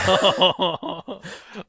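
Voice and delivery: very loud, high laughter; real belly laugh. Real, not acted. Friend remembering old memes with the group, laughing loudly. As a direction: burst out laughing helplessly. Cracking up at meme memories.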